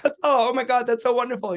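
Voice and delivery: high, gushing mock delight; mock gushing praise. Panel praising the new Nancy, high-pitched gushing. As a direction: gush as if bowled over. Casting panel gushes over audition.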